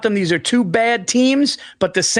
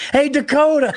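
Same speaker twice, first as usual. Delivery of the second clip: higher teasing call-out; mock heckle; 6 semitones higher than usual. Taunting Dakota from the stands, teasing call. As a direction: jeer at him with a grin. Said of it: "we should fuck with him when we go".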